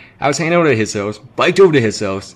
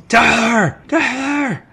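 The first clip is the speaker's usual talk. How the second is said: high yell of his name, twice; 12 semitones higher, much slower than usual. Retold or imitated. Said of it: "I'm yelling at him through the window".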